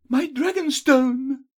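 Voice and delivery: high, loud, drawn-out dismay; heroine's slow, high exclamation of shock; shocked cry. A narrator's reading. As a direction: cry out at the stolen treasure. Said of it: "Sela gasped".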